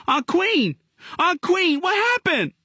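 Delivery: put-on frantic child calling out; screamed. A narrator's reading. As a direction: shout for her in sudden panic.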